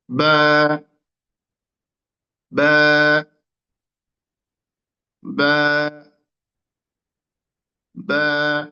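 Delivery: বা held long, pressed out from the two lips; demonstrating the letter. Shown as a demonstration. Teacher shows learners the lip letter, drawn-out বা. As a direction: sound out বা slowly, each one alone. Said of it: "খেয়াল করুন".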